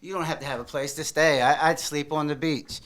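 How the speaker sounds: ordinary talk, nothing marked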